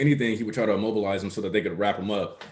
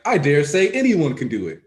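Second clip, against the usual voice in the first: higher-pitched quoted boast; Bakugo; 5 semitones higher, 4 dB louder than usual. Retold or imitated. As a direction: lightly act out a cocky character's line. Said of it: "Bakugo being like".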